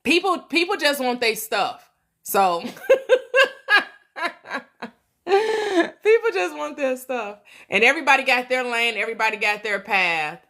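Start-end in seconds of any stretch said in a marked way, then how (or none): 2.7-3.8 short burst of high, loud laughter; real burst of laughter. Real, not acted. Laughs mid-point. 5.3-7.4 "people just want their stuff" said higher through leftover laughter; laughing. Real, not acted.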